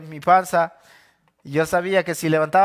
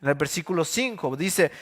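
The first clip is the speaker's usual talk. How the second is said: ordinary talk, nothing marked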